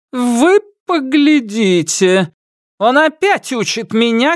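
put-on high, slow outraged old queen; indignant cry, stressing моим; indignant empress. A narrator's reading.

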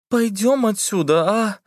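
put-on thin high girl's plea, slow; тоненький голосок. A narrator's reading. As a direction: ask timidly in a small high voice. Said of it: "внезапно тоненьким голосом попросила Лиза". (тоненьким голосом, робко)

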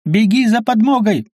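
high urgent cry; shout. A narrator's reading. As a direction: call out an order in a high voice. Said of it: "крикнула Астарта". (срочный высокий крик)

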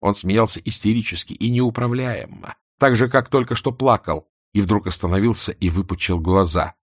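usual speaking voice

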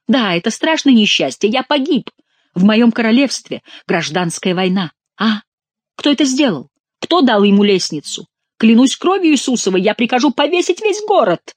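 high, indignant questions and oaths; agitated outburst. A narrator's reading. King learns of the escape and threatens the city, raging. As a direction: build to a high, angry threat.

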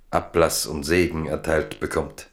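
usual speaking voice

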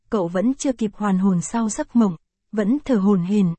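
usual speaking voice

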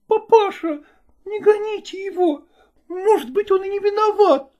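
high pleading daughter. A narrator's reading. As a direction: beg papa gently in a high voice. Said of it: "говорила она".

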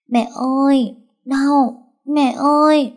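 put-on high, slow small child's cry of pain; child crying for mother. A narrator's reading. A dreaming mother hears her son cry for her in pain. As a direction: call out like a hurt little boy. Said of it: "Vừa rồi cô nghe tiếng con gọi".

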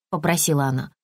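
usual speaking voice

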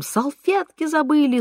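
high-pitched, mild wail; wailing cry. A narrator's reading. Son rushing out for forgotten napkins, wailing. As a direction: pitch it up in alarm. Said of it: "сын с воплем".

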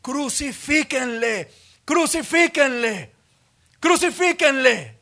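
slow, building to a loud high shout; crowd shouting. Acted. The crowd screaming for crucifixion, slow building shout. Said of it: "la gente comienza a gritar".

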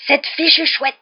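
loud, high-pitched angry shout; thundering uncle. A narrator's reading. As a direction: thunder it out in outrage. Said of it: "la voix tonitruante de l'oncle Vernon". (voix tonitruante, cri furieux)

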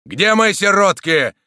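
loud, high angry roar; thundering. A narrator's reading. Soldier demanding his lost orphans, thundering. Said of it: "гремел Дагобер".

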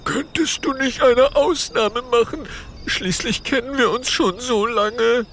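high, anxious pleading voice; terrified pleading hostage. Acted. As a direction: beg for mercy nervously. Topsy pleads with Ultimo.

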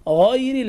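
"غير" with a dark, full gh; heavy ghayn. Shown as a demonstration. Teacher models correct reading, heavy gh in "غير". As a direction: thicken the opening of "غير". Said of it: "حرف الغين هكذا في القرآن".

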